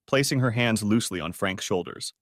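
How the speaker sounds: ordinary delivery, nothing marked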